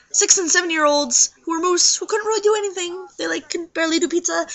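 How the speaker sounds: high, mock-crying whine; whiny complaint. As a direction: whine it like you're about to cry. Complaining about bratty kids.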